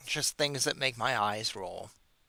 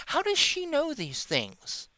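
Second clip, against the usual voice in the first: incredulous; 13 semitones higher, slower than usual. Real, not acted. Mocking rhetorical question.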